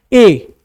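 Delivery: "ए" held out as a loud open vowel; demo vowel. Shown as a demonstration. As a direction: sound "ए" out loud and free. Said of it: "जैसे कि आप बोलोगे". (खुला ज़ोरदार "ए")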